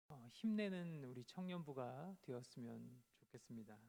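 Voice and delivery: usual speaking voice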